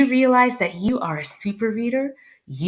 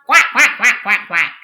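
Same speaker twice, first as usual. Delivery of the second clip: duck quacks; 4 dB louder than usual. Pretend. Imitating the duck for children.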